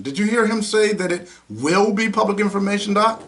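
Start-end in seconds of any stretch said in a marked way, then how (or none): none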